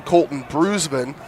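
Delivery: ordinary delivery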